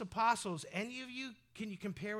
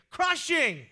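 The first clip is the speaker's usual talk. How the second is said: emphatic cry; 7 semitones higher, 12 dB louder than usual. Shown as a demonstration. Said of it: "It's crushing".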